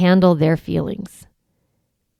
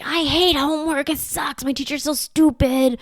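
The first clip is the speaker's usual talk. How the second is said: put-on higher, whiny teen complaining about homework; whiny older kid; 7 semitones higher than usual. Parent voicing a sulky teen hating homework, whiny.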